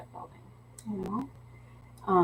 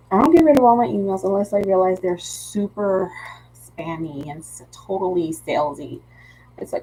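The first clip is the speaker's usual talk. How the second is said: ordinary delivery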